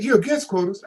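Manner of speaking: mock shocked question; surprised echo. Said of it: "They said".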